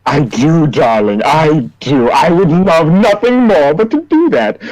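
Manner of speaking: theatrical diva purr rising high on the last line; flamboyant Pepper. Acted. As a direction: tease him like a smug showman. Player voices Pepper character.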